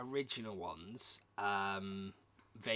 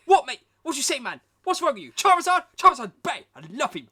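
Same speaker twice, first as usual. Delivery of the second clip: shouted high mock outrage; mock outraged fan; 12 semitones higher, 18 dB louder, faster than usual. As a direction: rant like an outraged fan defending Charizard. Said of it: "You're like".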